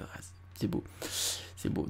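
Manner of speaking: softly whispered appreciation; whispered admiration. Real, not acted. After reading the poem, whispering his admiration. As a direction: breathe it out, savouring the verse.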